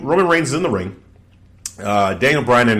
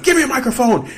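much higher, urgent demand; mock demanding Daniel Bryan; 14 semitones higher than usual. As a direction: bark for the mic. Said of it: "he's like".

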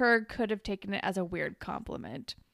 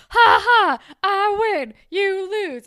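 high, very loud taunting crow; gloating; 11 semitones higher, 13 dB louder, slower than usual. Retold or imitated. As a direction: imitate a sore winner shouting in triumph. Acting Toby gloating to Rick.

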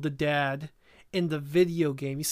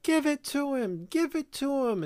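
high, urgent, insistent plea; urgent pleading; 9 semitones higher than usual. Retold or imitated. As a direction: push him to hand it over. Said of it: "Anne Hathaway's like".

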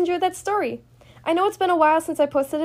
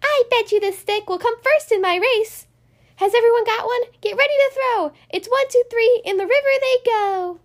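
put-on excited girl voice; 8 semitones higher than usual. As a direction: cheer the race on. Girl leads a stick race.

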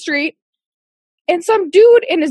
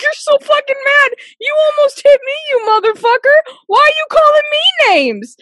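high-pitched, incredulous retort; laughing disbelief; 12 semitones higher, 4 dB louder than usual. Retold or imitated. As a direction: protest in amused outrage, voice pitched up. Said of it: "I started laughing".

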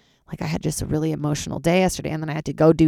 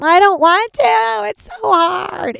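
high, very slow whine; whining; 5 dB louder, much slower than usual. Retold or imitated. Complaining about writing new material, high slow whine. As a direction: drag it out like a sulking kid.

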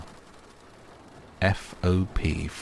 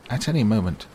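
raised, louder insistence; insistent; 5 semitones higher, 5 dB louder, faster than usual. A narrator's reading. Owl returning to his cut-off announcement, insistent. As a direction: press the unfinished point, pitched up. Owl, interrupted, tries to finish again.

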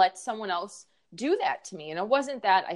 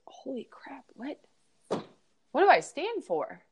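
stunned whisper; 7 semitones higher than usual. Retold or imitated. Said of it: "I realized".

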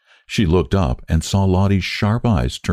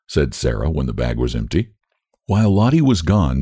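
plain reading voice

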